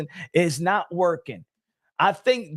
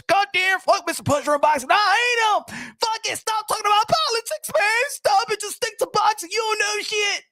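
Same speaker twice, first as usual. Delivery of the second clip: mocking angry commenters; 12 semitones higher than usual. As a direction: parody the commenters yelling at you. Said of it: "Motherfuckers be like this".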